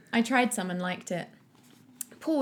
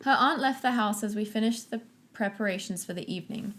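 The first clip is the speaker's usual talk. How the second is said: usual speaking voice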